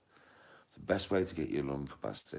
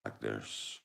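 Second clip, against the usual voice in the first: low, dropping voice; low starting voice; 5 semitones lower, 7 dB quieter than usual. Shown as a demonstration. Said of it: "start off talking like, like this".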